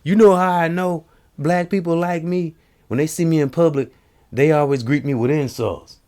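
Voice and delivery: high, put-on comic voice; comic quote. Quoting Jamie Kennedy's stand-up bit, high put-on voice. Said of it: "He's like".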